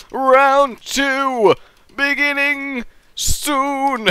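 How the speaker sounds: high, slow, stretched-out announcement; game announcer. Real, not acted. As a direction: hold each word like a ring announcer. Announces the next round.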